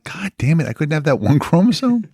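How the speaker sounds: playful whine of frustration; mock exasperation. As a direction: pretend to curse your rotten luck. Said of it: "you're like".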